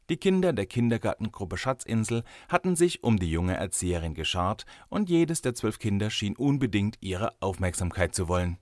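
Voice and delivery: plain reading voice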